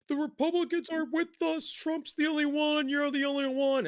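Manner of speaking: hammed-up high whimpering plea; a whiny, teary caller played for laughs; mock crying caller. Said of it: "is, like, crying about".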